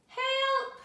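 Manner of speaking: high, loud call for help; lost princess calling out for help, high and loud; cry for help. A narrator's reading. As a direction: shout into the dark woods. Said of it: "she cried".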